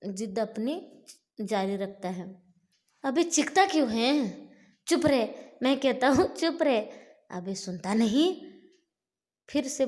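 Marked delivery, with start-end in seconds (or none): 3.0-6.8 loud, much higher angry yell; furious shout. Acted. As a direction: shout the demon down. Bhima shouts at the screaming demon. (राक्षस को चुप कराने के लिए ज़ोर से चिल्लाना)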